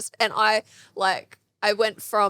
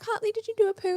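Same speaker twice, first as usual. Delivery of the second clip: put-on high, bright mum-to-toddler question; sweetly asking the toddler if he pooed; sing-song mum question; 14 semitones higher, faster than usual. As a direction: ask it like a mum checking on her toddler. Mum questioning toddler about poo.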